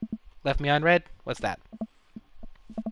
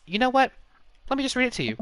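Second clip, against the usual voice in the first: voicing Amanda; 10 semitones higher, faster than usual. Amanda's quoted line, voiced high.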